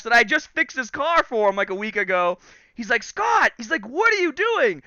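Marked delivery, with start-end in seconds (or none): 3.0-3.5 high, loud shout of his name; imitating a shocked friend calling out; alarmed call. Friend calls out to stop him. 3.9-4.8 high-pitched, alarmed question; imitating an appalled friend; shocked.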